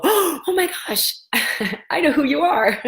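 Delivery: high, gasping delight at spotting her; breathless fan recognition; starstruck gasp. Retold or imitated. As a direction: squeal it like a fan who recognizes you. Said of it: "I have had people go".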